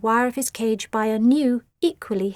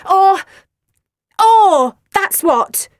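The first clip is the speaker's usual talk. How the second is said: much higher, much louder fumbling outburst; fuming; 10 semitones higher, 10 dB louder, much slower than usual. A narrator's reading. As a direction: sputter it in fury. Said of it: "fumed Nellie".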